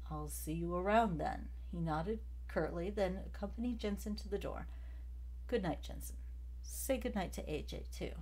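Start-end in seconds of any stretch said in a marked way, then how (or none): none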